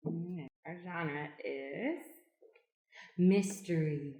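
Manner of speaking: normal talking voice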